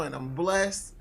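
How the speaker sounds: normal talking voice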